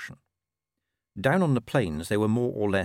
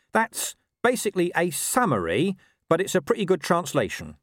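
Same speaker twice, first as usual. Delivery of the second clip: higher-pitched talking dog, matter-of-fact; explaining dog; 8 semitones higher, 3 dB louder than usual. Acted. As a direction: voice a dog giving his translation. Story figure, dog explains.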